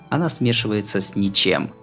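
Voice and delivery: ordinary delivery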